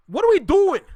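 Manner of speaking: high, loud cry of disbelief; exasperated shout. Real, not acted. Ranting at fellow Canadians, loud exasperated shout. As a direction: throw the question out in loud frustration.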